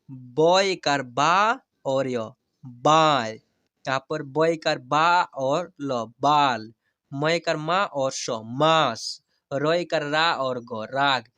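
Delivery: very slow teacher's demo, long stretched vowels; slow spelling-out. Shown as a demonstration. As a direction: spell each letter slowly, then draw out the whole word. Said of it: "जैसे मैं हिजे करा कर पढ़ रहा हूँ". (धीरे-धीरे हिज्जे करके, स्वर खींचकर)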